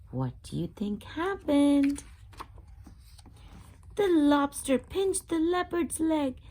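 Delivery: usual speaking voice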